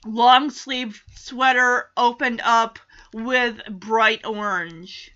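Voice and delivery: ordinary talk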